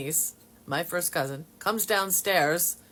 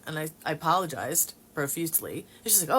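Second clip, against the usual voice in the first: normal talking voice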